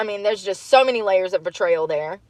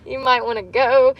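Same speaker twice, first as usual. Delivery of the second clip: normal talking voice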